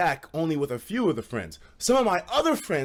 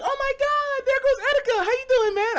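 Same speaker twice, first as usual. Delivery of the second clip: put-on much higher squealing girl voice; girls' voice. Girls greeting him off the dance floor, high squeal. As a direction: gush it like excited girls.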